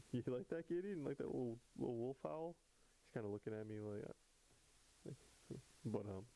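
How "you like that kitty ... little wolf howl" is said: higher, sing-song voice for the cat; cooing to a pet. Real, not acted. Talking to his cat Kitty.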